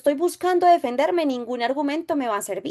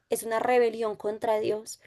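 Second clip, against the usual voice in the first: soft unvoiced whisper of the saint's words; whispered quote; 6 dB quieter than usual. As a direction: breathe the quote as a hushed secret. Cita susurrada de Santa Hildegarda. (cita susurrada en voz baja)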